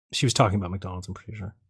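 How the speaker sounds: normal talking voice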